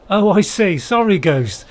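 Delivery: loud, sudden apology; loud sorry. Real, not acted. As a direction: blurt out sorry. Startled apology in game.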